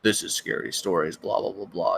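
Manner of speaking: lightly deepened horror-narrator voice; put-on deep voice. Shown as a demonstration. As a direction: slip slightly into a low scary-story narration. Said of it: "My voice is not that deep".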